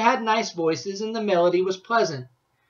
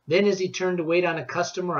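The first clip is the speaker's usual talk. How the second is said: usual speaking voice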